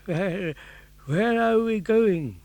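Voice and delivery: a frail father, lightly voiced; old Geppetto. Weak old Geppetto asks.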